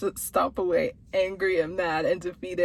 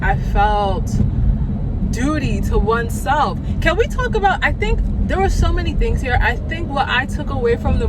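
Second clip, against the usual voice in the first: louder, higher, gushing praise; excited raving; 4 semitones higher, 8 dB louder than usual. Real, not acted. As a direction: rave about her like a fan. Raving about Viola Davis' acting.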